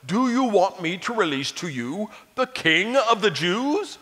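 ordinary delivery

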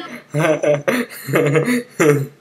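long, real giggling fit; laughing fit. Real, not acted. Viewer still cracking up at the cartoon, long laughing fit. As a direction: keep laughing helplessly.

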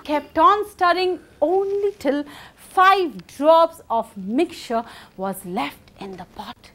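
ordinary delivery, nothing marked

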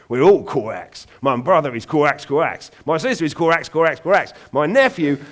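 loud, high, cawing Cockney raven; raven's London croak. Acted. Raven boasting of its family to Aubrey, loud Cockney caw. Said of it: "loud, throaty voice and an accent".

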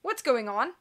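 mild demand from a startled banker. A narrator's reading. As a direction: press him for an answer, lightly. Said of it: "Mister Poe demanded".

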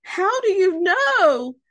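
high, loud, slow wail; frightened whimper. A narrator's reading. Frightened Duck asking Pig about the monster, wailing. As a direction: wail the question in storybook fright. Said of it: "she whimpered".

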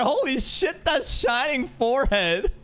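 high-pitched squeal of astonishment; shriek-laugh in utter bafflement; amused disbelief. Real, not acted. Startled laughing at new character.

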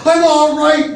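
high-pitched yell; high, urgent call; reassuring yell. A narrator's reading. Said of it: "Briggs yelled to reassure her".

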